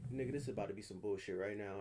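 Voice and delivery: quick, low, soft inner warning; low aside. Retold or imitated. Inner voice warns as two drunk guys approach, low aside. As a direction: say it quickly under the voice.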